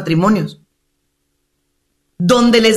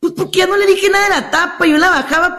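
higher, quick acted explanation; client's pitched-up hurried report; patient reporting back; 8 semitones higher, faster than usual. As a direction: rattle off the good news to the doctor. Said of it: "Llegan a la semana siguiente".

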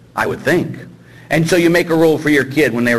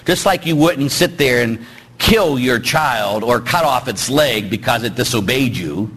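ordinary delivery

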